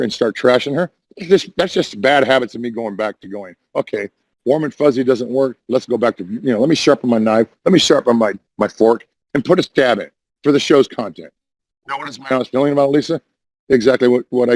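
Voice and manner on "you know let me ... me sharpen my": usual speaking voice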